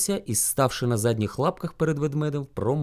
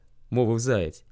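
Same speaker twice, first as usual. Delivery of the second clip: ordinary delivery, nothing marked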